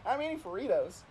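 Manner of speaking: ordinary delivery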